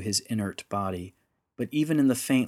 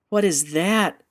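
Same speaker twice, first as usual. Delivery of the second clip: high, startled question with some force; gasped question; 8 semitones higher, 6 dB louder, slower than usual. A narrator's reading. Little girl spots something overhead, gasping in alarm. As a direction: gasp it out in alarm. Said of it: "Elyema gasped".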